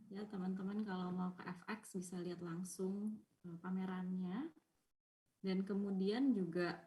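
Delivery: faint, as if far from the mic. Real, not acted. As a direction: voice barely coming through the mic. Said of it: "suaranya kecil sekali".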